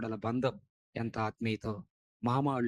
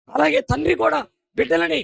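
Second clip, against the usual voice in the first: much higher, louder preaching; shouting preacher; 13 dB louder than usual. Acted. Preacher turning to fathers, loud preaching. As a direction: call it out to the fathers.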